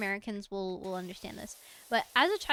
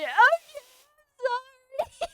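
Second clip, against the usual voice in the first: put-on high, slow, tearful child's sorry; crying apology; 13 semitones higher, 10 dB louder, much slower than usual. Scared child apologizing to mom, crying. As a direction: whimper it out like a scared kid. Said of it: "And I went".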